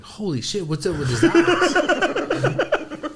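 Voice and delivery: high, slow, drawn-out gasp of disbelief; hammed-up shocked school principal. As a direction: play the stunned principal stretching every word. Principal voiced in retold story.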